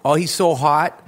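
lightly put-on Boston accent; a touch of Boston in the vowels. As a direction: imitate a Boston fan gushing. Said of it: "That's my Boston accent again".

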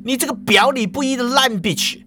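high angry insult; insult hurled. A narrator's reading. Alpha Beach insulting Yefeng mid-fight, high angry yell. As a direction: spit the insult mid-brawl. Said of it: "阿法bitch就说啦".

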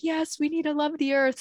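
high, earnest group assent; retold heartfelt agreement. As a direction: act out friends fervently agreeing.

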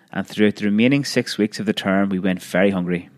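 plain reading voice

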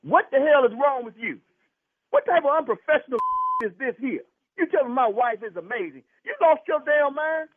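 high-pitched, heated telling-off; real outrage. Real, not acted. Husband berating doctor for eyeing his wife, outraged. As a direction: berate him in indignant disbelief.

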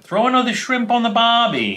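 high-pitched, badly faked Australian accent; horrible Australian accent. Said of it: "a horrible Australian accent".

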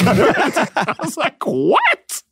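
high-pitched incredulous squawk. Retold or imitated. Unpaid performer to dismissive guard, incredulous. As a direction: voice leaps way up in disbelief. His retold disbelief at guard.

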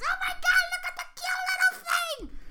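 put-on very high, fast squeal of baby talk; high-pitched motherly voice. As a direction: gush over the cute thing like a mom. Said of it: "motherly instinct voice".

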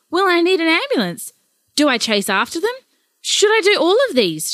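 frantic nana with questions tumbling out; panicky. Acted. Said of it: "Sandra was in a panic".

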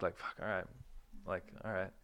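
very soft, low, giving up; deflated under-breath sigh; quiet resigned mutter. Retold or imitated. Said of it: "I was like".

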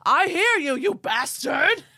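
loud furious yell at the sky. Retold or imitated. Ed yelling at the aliens in the sky, loud furious yell. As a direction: scream it up at the sky. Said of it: "he yelled".

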